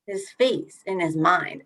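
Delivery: slowly spelling out "face"; slow explanation. Shown as a demonstration. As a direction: unpack it slowly, leaning on face. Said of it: "What is that?".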